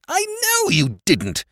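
high, loud raging complaint; furious tirade. Acted. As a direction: let all the anger out. Nephew continuing his shouted tirade.

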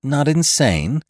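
high, slow rising question; drawn-out incredulous query; blank disbelief. A narrator's reading. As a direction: echo it back, baffled. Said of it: "turned to her brother blankly".

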